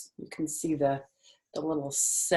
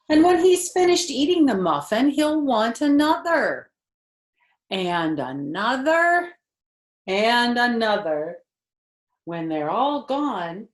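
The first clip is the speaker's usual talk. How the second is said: higher, louder storybook voice; reading to children; 8 semitones higher, 10 dB louder than usual. Acted. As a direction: read it brightly to a little listener. Reading picture book aloud.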